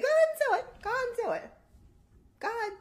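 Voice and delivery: teasing, squeaky-high egging on; high-pitched goading. Real, not acted. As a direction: dare him in a very high voice. Goads him to do laugh.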